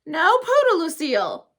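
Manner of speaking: high, loud sharp refusal; a grandma cutting off the begging; snappish. Acted. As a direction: snap it out firmly. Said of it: "said the Nana, very snappish".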